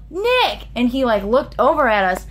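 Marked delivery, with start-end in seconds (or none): 0.1-0.7 high call to get his attention; a light, high retold yell; yelled name. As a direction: shout his name out to him. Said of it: "She yelled".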